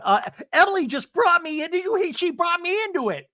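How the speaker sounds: very high, loud protest; mock wailing complaint. Acting out Alexis's loud complaint.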